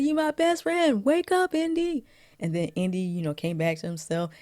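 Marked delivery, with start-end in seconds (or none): 0.0-2.0 retold plea, pitched way up; urgent mock cry, much higher. Short Round to Indy to wake him, high-pitched plea.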